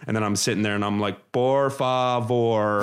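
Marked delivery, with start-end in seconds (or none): 1.3-2.8 slow, halting beginner Spanish; broken Spanish. Retold or imitated. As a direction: sound out the Spanish word by word, badly. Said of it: "just terribly to 'em".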